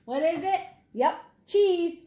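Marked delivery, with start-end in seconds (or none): none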